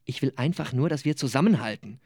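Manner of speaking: lebendige Romanlesung mit gespielten Figuren; Lesung. Acted. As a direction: lies die Szene wie bei einer Lesung vor Publikum. Autor liest aus seinem Roman. (Autor liest vor Publikum aus seinem Roman, lebhaft vorgetragen)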